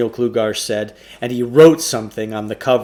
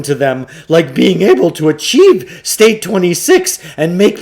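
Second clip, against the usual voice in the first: higher, louder salesman's patter; Samuel Klugarsh voice; 6 semitones higher, 9 dB louder than usual. Acted. Shopkeeper pushing a trade-in on the boys, higher, louder salesman voice. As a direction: sell it like an oily bookshop owner.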